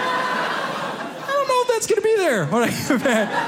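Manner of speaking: high, slow, uneasy doubt; worried self. Retold or imitated. Worried about car parking.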